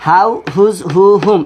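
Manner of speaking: "how", "whose", "who", "whom" with audible h at the front; pronounced with H. Shown as a demonstration. Teacher repeats the four exceptions, pronounced with h. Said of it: "এই চারটা হ দিয়ে হবে".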